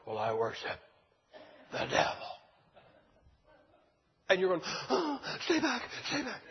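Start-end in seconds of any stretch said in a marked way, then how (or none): 0.1-2.3 low, half-whispered creepy confession; creepy devil worshiper. Acted. Satan worshiper answers door. 4.9-6.4 high, alarmed hammed-up recoil; mock fright. Visitor recoils from devil worshiper.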